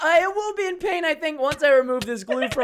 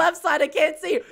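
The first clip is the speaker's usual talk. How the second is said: usual speaking voice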